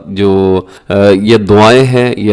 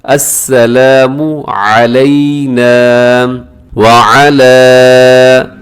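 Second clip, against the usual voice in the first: slow, drawn-out recitation; measured teaching chant; tajweed recitation; much slower than usual. Shown as a demonstration. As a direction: recite slowly with long held vowels. Recites for learners.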